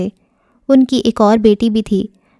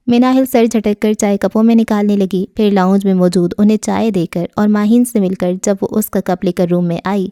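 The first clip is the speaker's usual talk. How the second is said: ordinary delivery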